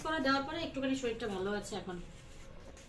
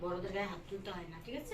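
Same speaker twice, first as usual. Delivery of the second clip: soft admonishing; 4 semitones lower, 10 dB quieter than usual. Real, not acted. Gently scolding child about hitting.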